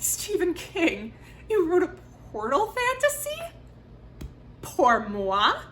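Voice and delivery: high-pitched, incredulous gushing question; delighted disbelief. Retold or imitated. Reader reacting to a Stephen King fantasy announcement, delighted disbelief. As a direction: play up the astonished delight, pitch leaping.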